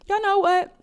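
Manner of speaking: high, loud burst to the audience; excited outburst. Real, not acted. Vlogger suddenly calling out to her viewers, excited. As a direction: throw it out like a sudden reveal.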